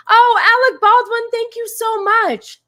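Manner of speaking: squealing, high, gushing thank-you; excited gushing thanks. Real, not acted. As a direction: burst out in delighted gratitude. Thanking a big gifter.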